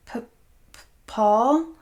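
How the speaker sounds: a scared woman stammers her abuser's name; stammering in fear. Acted. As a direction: stumble over his name, terrified. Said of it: "Scarlett stammered".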